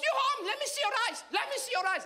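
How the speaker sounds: very high, sudden mom interrogation; strict immigrant mother. Acted. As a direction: pounce on him as a suspicious mother. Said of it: "and she goes".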